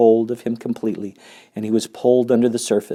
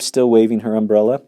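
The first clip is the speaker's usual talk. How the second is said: plain reading voice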